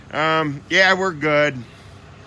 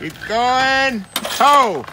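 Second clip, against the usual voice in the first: calling out; 10 semitones higher, much slower than usual. Real, not acted. Calling to driver.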